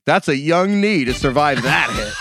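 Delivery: slow, drawn-out broadcaster voice, half laughing; mock announcer awed by a hit; Troy Aikman impression. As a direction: do a lightly voiced awed commentator. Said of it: "Troy Aikman, like, gasped and is like".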